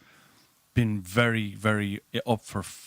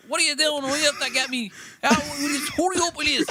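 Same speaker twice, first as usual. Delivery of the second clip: high, loud impatient complaint; impatient queue; 3 dB louder than usual. Retold or imitated. People in the queue complaining at the delay, high and impatient. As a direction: moan at the hold-up. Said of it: "headbangers behind".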